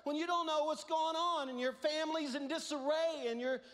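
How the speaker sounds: loud, high sermon climax; fiery preaching. Real, not acted. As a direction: preach it with full force. Preaching at full force.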